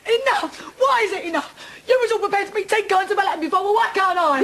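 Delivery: high-pitched, anguished challenge; outraged protest. Acted. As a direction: fling it back at him, high and overwrought. Furious protest at being stopped.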